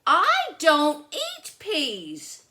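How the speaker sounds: high, loud, slow refusal; stubborn little sister. Acted. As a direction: dig your heels in like a picky kid. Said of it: "said Lola".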